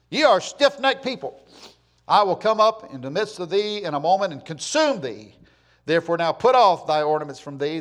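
loud, high preaching declamation; forceful scripture reading. Acted. Preacher reads God's rebuke of Israel, forceful scripture reading. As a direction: thunder the verse out to the congregation.

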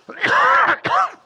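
loud, high, strained real cough; a sudden coughing spasm mid-sermon; real coughing fit. Real, not acted. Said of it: "now I've worked myself up".

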